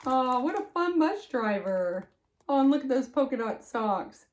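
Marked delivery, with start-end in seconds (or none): none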